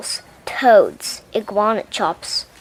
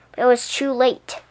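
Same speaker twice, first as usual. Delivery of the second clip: usual speaking voice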